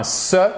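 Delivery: SE as an isolated hissed s; unvoiced s plus a short vowel; S sound. Shown as a demonstration. Teacher lists three endings for students, demonstrative s sound. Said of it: "la prononcer de trois manières différentes".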